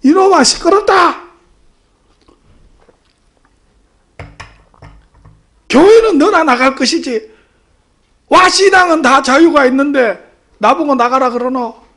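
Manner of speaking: loud, high-pitched scolding; angry father yelling. Retold or imitated. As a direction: shout your son down over the phone. Father yells, then hangs up. (버럭 호통치는 아버지)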